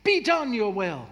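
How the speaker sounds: loud, high, forceful command; commanding declaration. Shown as a demonstration. Preacher shows how to pray, commanding and loud. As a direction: put your foot down and declare it. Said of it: "raising their fist and saying".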